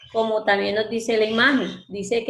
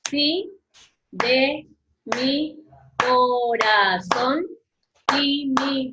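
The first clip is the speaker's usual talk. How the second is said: slow, split into syllables; teacher counting syllables aloud; 3 semitones higher, much slower than usual. Shown as a demonstration. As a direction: say each syllable apart, slowly. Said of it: "dividámoslo en, por sílaba".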